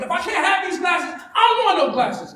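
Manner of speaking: put-on high-pitched child tantrum; spazzing out. Twelve-year-old throwing the glasses down, high tantrum shout. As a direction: throw a kid's fit at Mom. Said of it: "I spazzed out".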